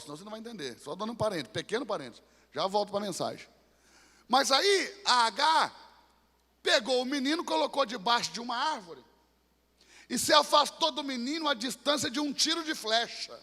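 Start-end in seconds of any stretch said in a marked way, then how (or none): none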